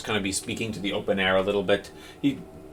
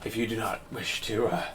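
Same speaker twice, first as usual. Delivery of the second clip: softer, cracking priest's voice through tears; a touch of a weeping priest, softer; hoarse, broken voice; 2 semitones lower, 9 dB quieter than usual. Acted. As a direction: speak through tears, barely holding together. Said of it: "his voice is just, like, hoarse and, and broken".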